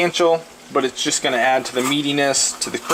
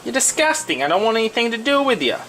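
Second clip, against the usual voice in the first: squeaky high, sneering put-down; mock someone in a much higher voice; 9 semitones higher than usual. Mock voice insulting vegan.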